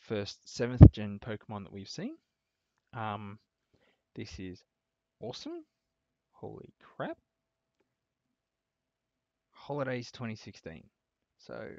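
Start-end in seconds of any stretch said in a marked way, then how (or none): 5.2-7.2 squeaky high awesome; excited. Real, not acted. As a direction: pitch leaps up in delight. Reacting to new Pokemon.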